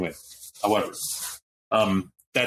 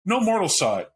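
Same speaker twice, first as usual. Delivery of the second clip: normal talking voice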